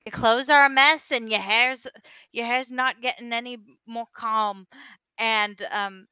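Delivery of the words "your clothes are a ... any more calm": loud, high scolding rant at a lad; scolding dwarf barkeep. Acted. Dwarf barkeep loudly scolding the messy young bartender. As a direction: berate the young bartender for the mess. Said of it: "he's gonna be like".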